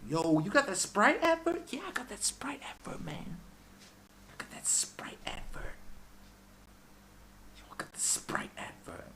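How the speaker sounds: high, hushed mock rapper voice; mock rapper brag, hushed and high; whispered rapper brag. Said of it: "Yo, Three Six Mafia".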